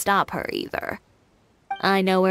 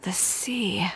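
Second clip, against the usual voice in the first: whispered; 5 dB quieter than usual. Acted. Quiet murmur to herself.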